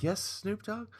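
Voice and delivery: very high, soft, starstruck answer; hopeful. Retold or imitated. Opener turning round to the star, meek and hopeful. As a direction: answer your idol in a tiny, high voice.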